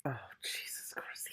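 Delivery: breathy, slow under-the-breath aside; slow, soft whisper of exasperation; exasperated whisper. Real, not acted. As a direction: whisper it like you can't believe him. Reader reacting to lying cat.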